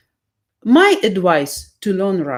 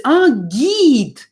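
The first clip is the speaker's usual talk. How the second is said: high, louder "ah" of getting it; sudden realisation; 6 semitones higher, 4 dB louder than usual. Retold or imitated. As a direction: imitate the tourist finally understanding. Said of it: "And she say".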